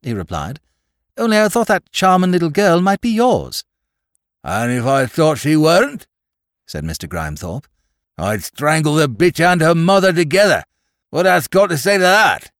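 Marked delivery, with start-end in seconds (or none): 1.2-3.6 high, louder polite explanation; smooth it over pleasantly; placating. A narrator's reading. Placating the angry farmer. 4.4-6.1 loud, angry farmer's threat; menacing. A narrator's reading. Farmer threatening wife and child. 8.2-12.5 high, loud farmer's tirade; enraged threat. A narrator's reading. Farmer threatening wife and child.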